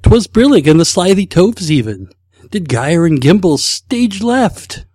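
a bad cartoon-lion voice, dragging out stage left; Snagglepuss impression. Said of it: "I am a complete loss when it comes to impressions".

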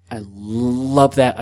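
long held L on "love", slow; drawn-out LOVE. Real, not acted. Gushing over a printer that works, LOVE stretched out. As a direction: linger on the start of "love", savoring it.

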